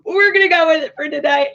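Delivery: laughing through the words; breaking into laughter. Real, not acted. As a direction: give in to a laugh at your own made-up word. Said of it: "I don't think that's a word".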